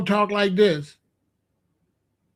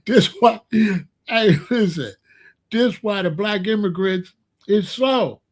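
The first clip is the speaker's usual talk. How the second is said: ordinary talk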